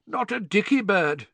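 high, untroubled cheer; comfortable. A narrator's reading. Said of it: "said Bagman comfortably".

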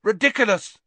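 high, loud spell cry; yelled. A narrator's reading. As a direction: yell it like an incantation. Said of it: "yelled Dean".

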